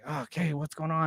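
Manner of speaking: quiet puzzled mutter; hushed confusion; confused mutter. Retold or imitated. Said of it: "I'm like".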